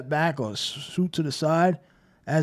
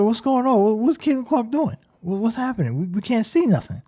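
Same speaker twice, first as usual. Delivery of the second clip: higher, puzzled viewers asking what's up; confused viewers; 7 semitones higher than usual. Retold or imitated. Viewers at home, confused by a blocked shot, asking what's going on. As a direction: play baffled TV viewers who can't see the game. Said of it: "people are like".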